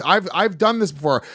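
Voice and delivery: usual speaking voice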